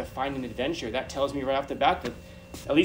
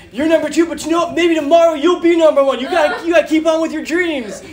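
high, loud, laughing joy; ecstatic winner; 14 semitones higher, 14 dB louder than usual. Retold or imitated. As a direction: gush like a champion.

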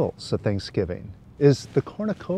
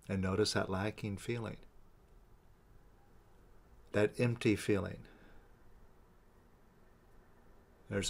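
soft, unhurried meditation guide with long pauses; guided meditation; 6 dB quieter than usual. Real, not acted. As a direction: guide them gently inward, leaving space between phrases. Leads a releasing meditation.

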